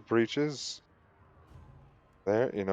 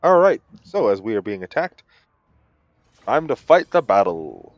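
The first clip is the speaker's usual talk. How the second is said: normal talking voice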